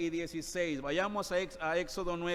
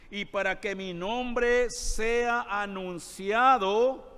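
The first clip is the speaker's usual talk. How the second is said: emphatic scripture reading; 4 semitones higher, 5 dB louder, slower than usual. Acted.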